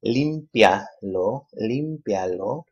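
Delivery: límpialo very slow, LÍM punched; slow teacher's límpialo, loud accented LÍM; stress on LÍM. Shown as a demonstration. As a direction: stretch límpialo out, leaning on the first syllable. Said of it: "emphasis is on the third to the last syllable".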